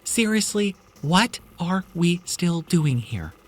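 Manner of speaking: high, loud, stretched ending; scared and insistent; frantic pleading. Acted. Mia refusing to stay.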